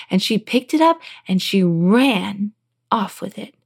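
plain reading voice

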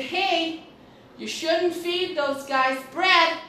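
high, loud, drawn-out holler; retold distant yell. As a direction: re-enact yelling over to someone far away. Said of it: "So I yelled over there because she was pretty far away".

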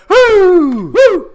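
high loud wrestling woo; Flair woo. Pretend.

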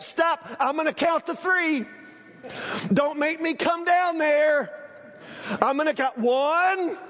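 sing-song warning that nobody obeys; lazy parent threat. Retold or imitated. Preacher mocking a parent who counts to three, lightly voiced nag.

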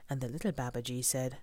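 usual speaking voice

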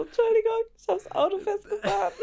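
high, hurried apology; mock apology. Acting out an apology to bystanders.

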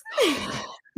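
high surprised gasp; sharp gasp. Real, not acted. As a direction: gasp in sudden realisation. Sudden realisation about the weekend.

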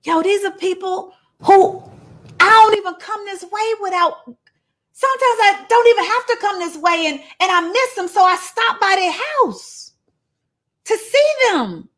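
high-pitched, exasperated rant; deeply troubled. Acted. Jesus venting about people who doubt him, deeply troubled. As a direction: vent in a high voice, deeply put out. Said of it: "he was deeply troubled".

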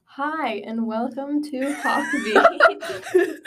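slow announcer greeting; morning announcer. Pretend. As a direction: stretch it like a radio host. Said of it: "You sound like a morning announcer".

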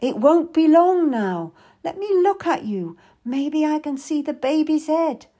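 keen, encouraging helper. A narrator's reading. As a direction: urge her on with hope. Meady encourages the labouring mother.

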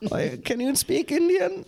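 very high, slow questioning voice; puzzled foreigner imitation. Quoting foreigners asking about the language, high and slow. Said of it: "So they were like".

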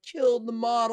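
high-pitched mock heckler; jeering crowd. Imitating the whistling, booing crowd.

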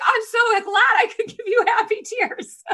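put-on very high, loud child voice; little daughter. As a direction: imitate a delighted little girl. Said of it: "She's like".

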